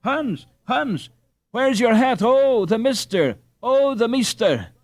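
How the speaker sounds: high, loud frantic cries for her son; a mother rushing about, calling out in alarm; crying out frantically. Acted.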